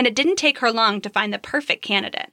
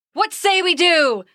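higher, louder, slow mock roar; 8 semitones higher, 4 dB louder than usual. A narrator's reading. Zeus roars his agreement to Aphrodite, a slow booming roar. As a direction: boom it out like a thundering god. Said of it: "Zeus roared again".

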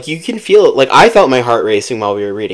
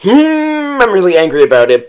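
indignant sore loser; 7 semitones higher than usual. Retold or imitated. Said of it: "he's just like".

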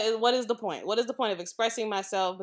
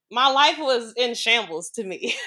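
louder, with a laugh in the voice; 4 semitones higher, 8 dB louder than usual. Real, not acted. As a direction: laugh off the pain as you say it. Laughs off a painful memory.

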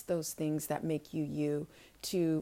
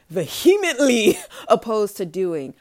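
laughing; 2 semitones higher, 10 dB louder than usual. Real, not acted. Laughing at her own reluctance.

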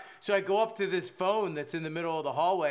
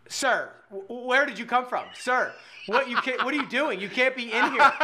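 higher, louder alarmed clerk; 6 semitones higher, 5 dB louder than usual. Retold or imitated. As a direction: half-shout it like a startled clerk. Alarmed desk clerk quoted.

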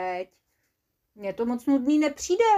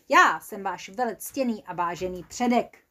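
plain reading voice

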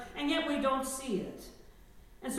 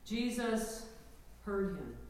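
usual speaking voice

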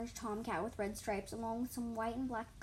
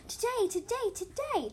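high, loud kitten squeal; bursting high with excitement; excited squeal; 12 semitones higher, 7 dB louder than usual. A narrator's reading. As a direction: squeal it over and over, thrilled. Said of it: "Ginger Kit squealed".

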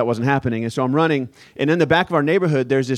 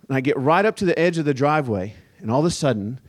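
ordinary talk

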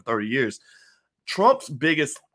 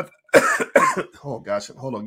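slow, choking, breaking into coughs; words cut off by choking; choking on a drink; 5 dB louder, much slower than usual. Real, not acted. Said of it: "while I finish choking".

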